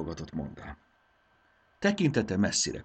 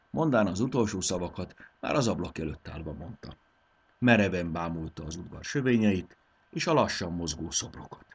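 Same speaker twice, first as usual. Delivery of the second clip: usual speaking voice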